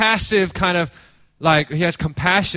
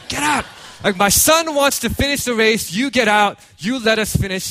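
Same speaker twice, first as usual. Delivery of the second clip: higher-pitched, forceful mock outburst; acted indignant protest; 4 semitones higher than usual. Father telling officials to let his son finish, acted indignant outburst. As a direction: push them off like a protective father.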